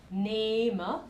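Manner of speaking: "nehme" with a long ee-ay vowel. Shown as a demonstration. Teacher showing the long vowel before H. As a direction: stretch the E in "nehme". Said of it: "entonces está alargada".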